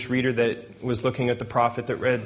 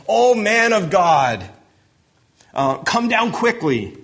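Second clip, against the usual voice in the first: higher and louder, stretched out slowly; loud, slow summons; 8 semitones higher, 5 dB louder, slower than usual. Acted. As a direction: bellow the order up the hill, unhurried. Second captain calls up hill.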